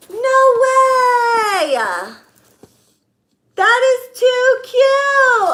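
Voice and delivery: very high, loud, drawn-out delight; thrilled squeal. Real, not acted. Seeing a client's custom gift, thrilled squealing. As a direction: squeal slowly over something too cute.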